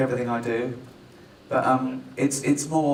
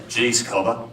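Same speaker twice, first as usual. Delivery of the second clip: lower-pitched, put-on Aussie accent; mock Australian accent; 5 semitones lower than usual. Director mocking his Australian actor, put-on Australian accent. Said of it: "is that a good Australian accent?".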